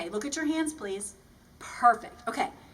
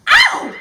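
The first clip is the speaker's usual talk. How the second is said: loud yelp; 20 dB louder than usual. Acted. Sudden pained yelp.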